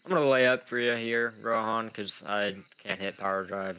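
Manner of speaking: ordinary delivery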